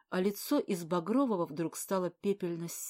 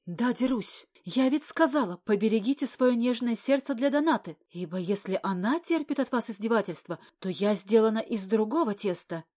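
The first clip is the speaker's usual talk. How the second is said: defiant; 3 semitones higher, 6 dB louder than usual. Acted. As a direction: throw it back at him, raised voice. Defiant after slapping him.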